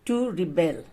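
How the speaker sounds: rebel as a verb, re-BEL, low and soft; stress on second syllable. Shown as a demonstration. Teacher showing how the verb is said, rebel stressed on BEL. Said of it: "is pronounced as".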